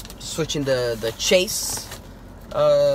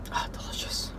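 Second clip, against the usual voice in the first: whispered, savouring; whispered delicious; 12 dB quieter than usual. Real, not acted. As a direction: breathe it out with pleasure. Smelling again.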